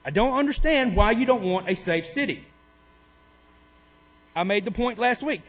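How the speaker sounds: ordinary delivery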